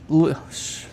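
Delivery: tripping over a lisp; tongue slips into a lisp. Real, not acted. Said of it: "apparently I got a lisp today".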